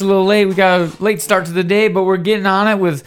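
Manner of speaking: loud, high-pitched podcast host opening; powerful, high-energy intro. Real, not acted. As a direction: launch the show at full energy. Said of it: "That was a powerful, high-energy intro".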